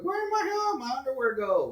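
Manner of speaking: high puzzled question; baffled, searching, pitched high; exasperated. Retold or imitated. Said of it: "And I was like".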